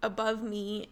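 usual speaking voice

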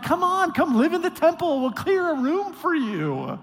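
put-on a priest waving Tobiah in like an old friend; the priest invites Tobiah into the temple, high and easy; mock priest. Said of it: "The priest was like".

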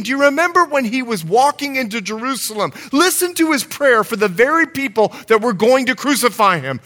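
high, fervent sermon delivery; impassioned preaching. Real, not acted. Preacher showing the congregation the heart of Jesus, impassioned.

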